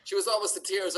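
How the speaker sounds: normal talking voice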